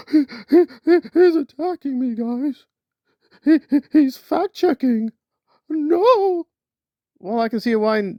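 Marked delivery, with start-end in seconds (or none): 0.1-6.5 high, loud panicky whine; mock whining. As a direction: shriek it like a fake victim. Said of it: "whining and crying like".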